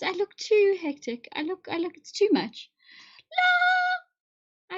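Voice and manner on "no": shrill high no; high loud no; high dismayed cry. Real, not acted. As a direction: cry out as if trapped. Trapped in a bad filter.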